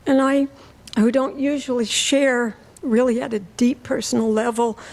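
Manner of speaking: ordinary talk, nothing marked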